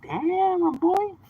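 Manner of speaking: a hint of a put-upon customer; mock customer voice. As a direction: pitch it up to mimic a customer.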